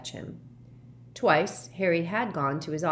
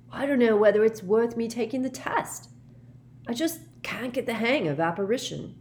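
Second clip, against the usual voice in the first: higher-pitched, mildly exasperated grumble; discouraged; 6 semitones higher than usual. A narrator's reading. Ron to Harry about the apparition test, discouraged. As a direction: grumble with a touch of self-doubt. Ron doubting he can pass.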